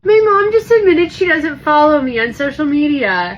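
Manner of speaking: high, loud mock outrage. Real, not acted. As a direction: play up the betrayal, loud and high.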